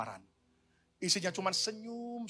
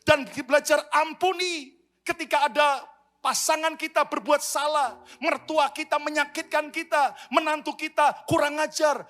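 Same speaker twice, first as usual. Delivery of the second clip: higher, louder preaching appeal; full-force preaching; 9 semitones higher, 7 dB louder than usual. Acted. Pastor exhorts couples to forgive, preaching at full force. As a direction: drive it home to the congregation.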